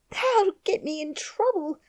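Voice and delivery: high frightened Yorkshire plea; scared near tears; half crying. A narrator's reading. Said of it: "half crying".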